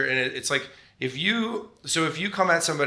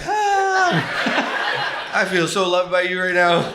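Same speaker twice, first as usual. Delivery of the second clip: mock hurt; 5 semitones higher, 6 dB louder, much slower than usual. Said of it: "And I'm like".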